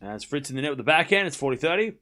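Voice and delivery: brisk live tennis commentary, lifting on points; play-by-play call. Acted. Streamer calling Wong against Anisimova, live play-by-play. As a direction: call each rally as it happens.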